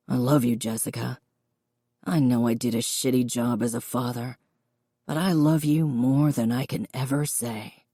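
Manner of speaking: low, hushed father confessing his love; contrite loving father. Acted. Father declaring love to his daughter.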